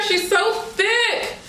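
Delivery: high, slow, stretched out; awed delight. Real, not acted. Marveling at the wig to viewers. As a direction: savor every word.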